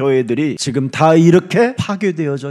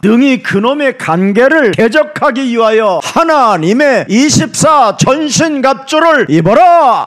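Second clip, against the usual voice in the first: high, commanding cry, slowing at the end; full-force preaching; 7 semitones higher, 5 dB louder than usual. Acted. Preacher commanding listeners to put on armour, full force. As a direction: proclaim it like a battle order.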